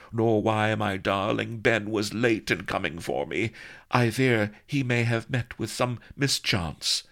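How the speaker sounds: high, melodramatic lady voice; fretting heroine. A narrator's reading. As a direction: worry daintily over an absent sweetheart. Vanity frets about her darling.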